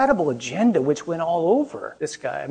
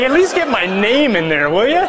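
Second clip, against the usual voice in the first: mock indignant plea; 7 semitones higher, 6 dB louder than usual. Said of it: "He's like".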